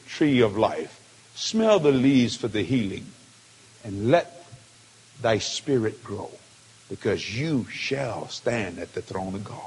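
low, measured voice of heavenly encouragement; solemn exhortation. Retold or imitated. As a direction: drop deep and pronounce it like a blessing.

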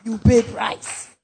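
forceful preacher shouting; preaching. Acted. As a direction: preach it out loud.

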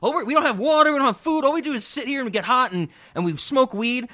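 high, loud panicked rant; imitating a freaking-out friend. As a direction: mimic him losing it over no water. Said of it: "he's like".

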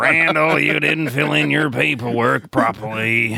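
put-on, drawn-out scolding drone; Roz. Roz to Randall about paperwork, put-on scolding voice. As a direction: scold slowly in a hammed-up character voice.

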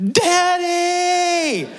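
very high, shouted "Daddy!"; terrified child's cry. Retold or imitated. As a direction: scream for dad as the ride goes backwards. Daughter grabs him going backwards.

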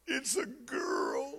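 slow, tearful whisper; imitating his crying wife, softly. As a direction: whisper it through tears like bad news. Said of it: "she started crying".

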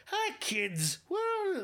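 high-pitched imitation Irish accent; mock Irish teacher. Host mocking the movie's teacher, high put-on Irish voice. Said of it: "the fucking teacher's voice".

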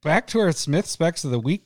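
announcer voice. Acted.

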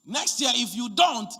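loud, slow scolding ultimatum; mother-in-law threatening the wife, loud; demanding mother-in-law. Retold or imitated. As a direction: bark the threat down the phone. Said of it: "mother-in-law call and tell her".

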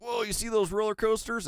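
high-pitched retold amazement; excited. Friends spotting roller coasters from the car, excited. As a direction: re-enact an excited first sighting, voice lifted.

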